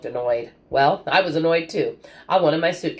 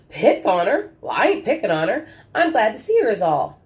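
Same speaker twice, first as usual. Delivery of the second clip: a hint of a jovial old man, higher; lightly voiced old grandfather; 7 semitones higher, 4 dB louder than usual. A narrator's reading. Grandfather answering his grandson, lightly voiced and jovial. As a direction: protest with a wink in it. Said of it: "grandfather protests, jovial".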